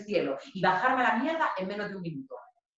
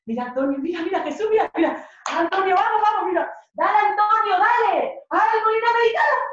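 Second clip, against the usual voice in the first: high, loud cheering, getting more excited; 11 semitones higher, 8 dB louder than usual. Retold or imitated. Girlfriend cheering her boyfriend at the breakdance show, high and loud. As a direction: cheer him on, rising higher and louder.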